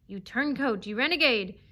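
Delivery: loud, hate-filled name-calling; contemptuous accusation. A narrator's reading. Fox denouncing the traitor dog, contemptuous. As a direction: hurl each insult with scorn. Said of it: "taut with contempt and hatred".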